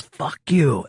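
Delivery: ordinary delivery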